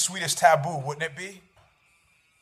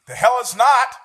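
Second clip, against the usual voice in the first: higher and louder, "The hell it's not" fired back; defiant comeback; 6 semitones higher, 8 dB louder than usual. Acted. Host answering the doubter, defiant and loud. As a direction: bristle and shoot it straight back.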